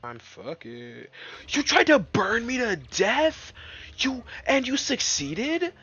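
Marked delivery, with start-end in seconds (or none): 1.5-5.7 much higher, much louder slow indignant shout; outraged yell. Real, not acted. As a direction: yell at the enemy like it betrayed you. Yelling at enemy who burned him.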